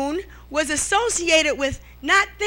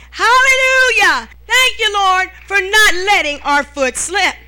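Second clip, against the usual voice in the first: high, loud cry of hallelujah; ecstatic praise; 4 semitones higher, 6 dB louder than usual. Real, not acted. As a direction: burst out praising the Lord. Praise outburst in sermon.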